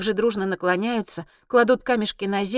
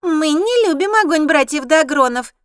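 high, loud squeaky harpy; taunting shrill creature voice; 7 semitones higher, 8 dB louder than usual. A narrator's reading. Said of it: "песклявая серокрылая".

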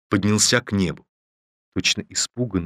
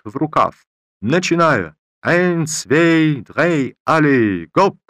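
loud, higher, slow conjuror patter; booming circus ringmaster; 6 semitones higher, 5 dB louder, slower than usual. A narrator's reading. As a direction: work the crowd like a fairground magician. Talman's conjuror patter, broken Russian.

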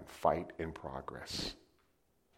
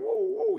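siren woo; 7 dB louder than usual. Retold or imitated. Siren sound.

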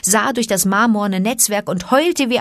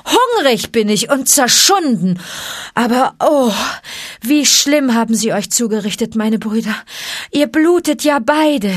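put-on higher, louder boyish voice; dismayed boy; 6 semitones higher, 4 dB louder than usual. A narrator's reading. Boy sees his friends bleeding, dismayed. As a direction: cry out in shock and sympathy.

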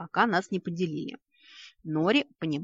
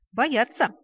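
loud, higher proud word; proud explanation; 7 semitones higher, 7 dB louder than usual. Acted. The elf proudly explains why the guards fear them. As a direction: say it with smug pride. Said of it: "гордо пояснил эльф".

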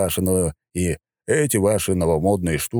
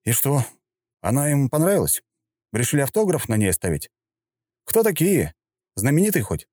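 high teasing sarcasm; mocking; 8 semitones higher than usual. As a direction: ridicule him lightly. Hero mocks the general.

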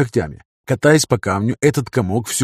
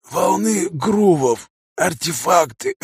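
higher, slow, strained croak; dying angel voice; 6 semitones higher, much slower than usual. A narrator's reading. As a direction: force each word out, fading. Умирающий ангел отвечает. (хрипящий умирающий голос)

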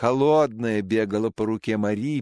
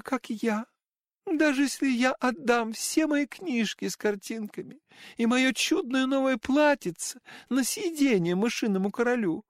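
put-on tearful girl voice; 11 semitones higher than usual. A narrator's reading. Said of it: "рыдая".

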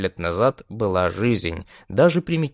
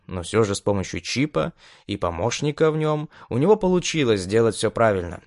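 plain reading voice

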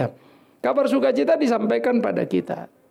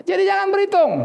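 forceful command; 13 semitones higher, 4 dB louder than usual. Real, not acted. Sermon climax command.

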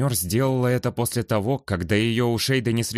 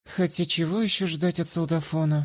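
mocking; 9 semitones higher than usual. Flogia mocks the soldier.

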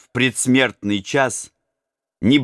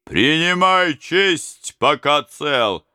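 slow threat; 6 semitones higher, much slower than usual. Acted. Robber threatens prince.

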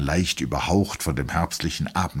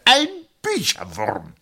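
much higher, slow, doting; fond caressing tone. A narrator's reading. Colonel repeats the word about the doctor, doting. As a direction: savour the word fondly. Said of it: "in dem liebkosenden Ton".

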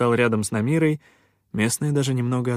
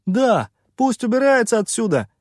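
high, loud heckle from the crowd; angry shout; 12 semitones higher, 6 dB louder than usual. A narrator's reading. Villager behind the miner demands pirate leave, shouting. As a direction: yell it out in protest. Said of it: "выкрикнул кто-то из-за его спины".

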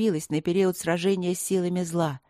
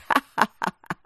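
evil laughter; 3 semitones higher, 3 dB louder than usual. A narrator's reading. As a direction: otherworldly cackle of evil. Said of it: "Зло расхохоталось". (зловещий хохот)

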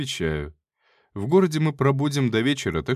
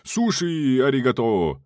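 slow, lightly mocking fake Japanese; 3 semitones higher, 3 dB louder, slower than usual. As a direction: tease with a put-on accent.

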